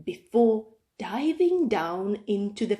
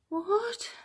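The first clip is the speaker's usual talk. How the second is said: high whispered surprise; puzzled hushed question; whispered what; 11 semitones higher, 6 dB quieter than usual. Acted. Cub puzzled.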